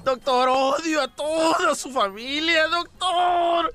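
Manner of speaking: high, wailing mock sobbing; crying Piolín. Piolín whining to the doctor, high and tearful. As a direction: wail every doctor like a crybaby. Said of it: "dice Piolín llorando".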